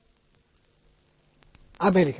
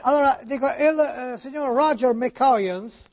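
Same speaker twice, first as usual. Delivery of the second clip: put-on Italian spoken with an American accent. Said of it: "come parla Niculo? ... oggi americani".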